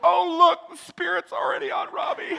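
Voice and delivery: high, hammed-up voice of an awed worshipper; gushing churchgoer. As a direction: imitate delighted churchgoers in the back. Mimics people in the back rejoicing.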